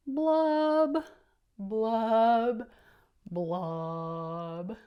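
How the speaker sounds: loud bubbly fish blubs. Acted. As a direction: blow out three fishy blubs. Fish noise, bubbling sounds.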